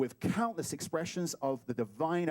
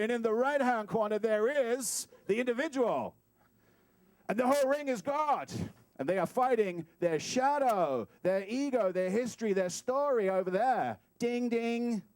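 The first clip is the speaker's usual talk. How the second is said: raised ring-announcer proclamation; boxing promoter; 6 semitones higher, 4 dB louder than usual. Pretend. Introducing the individual against their shadow, boxing promoter. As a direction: introduce the fighters with mock grandeur, down to the bell. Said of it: "It's my boxing promoter moment".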